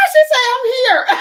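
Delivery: high, loud delighted outburst; thrilled, breaking into a cackle; excited squeal. Real, not acted. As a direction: burst out high through laughter. Delighted outburst on reading a comment.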